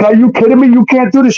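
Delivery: high-pitched, indignant disbelief; incredulous protest. Retold or imitated. Confronting Crystal as she moves the kids in, incredulous. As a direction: object in disbelief, pitch jumping up. Acted quarrel over children in house.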